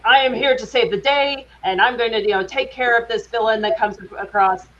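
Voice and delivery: earnest stagey superhero declaration; hammy heroic stage tone; Arctica. Shown as a demonstration. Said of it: "Arctica is more like".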